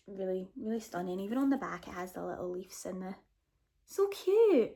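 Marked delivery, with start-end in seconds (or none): 3.9-4.7 high cooing squeal; real delighted coo, much higher. Real, not acted. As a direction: coo over the tiny leaves. Cooing over cover detail.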